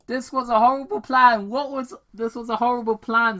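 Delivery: high, loud, fast grumble; complaining. Acted. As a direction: whine about the plan. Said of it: "Sonic complained".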